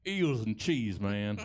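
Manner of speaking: lightly put-on Southern husband; mock Southern accent. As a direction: say it as a Southern good ol' boy. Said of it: "Somebody's southern husband is here".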